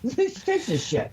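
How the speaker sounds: high, slow, put-on snooty voice; mock a wine snob. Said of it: "No, I said".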